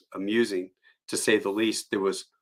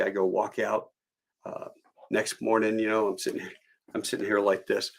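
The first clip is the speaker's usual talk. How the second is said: ordinary delivery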